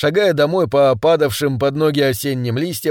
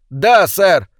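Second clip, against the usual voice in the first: higher, firm obedient answer; schoolboys' raised-voice response; loud reply; 4 semitones higher, 4 dB louder than usual. A narrator's reading. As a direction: answer up smartly for the teacher. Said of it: "Повторите громко".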